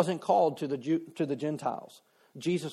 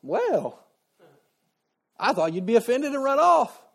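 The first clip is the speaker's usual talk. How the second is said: pitched up high, pleasantly surprised Jesus; a smirking, raised-up well-I-never; amused surprise; 7 semitones higher, 4 dB louder than usual. Retold or imitated. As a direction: caught off guard and amused, voice lifted. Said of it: "and thought".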